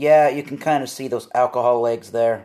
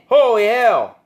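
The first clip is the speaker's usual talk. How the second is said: shocked exclamation; 7 semitones higher, 6 dB louder than usual. Real, not acted. Shocked by beer strength.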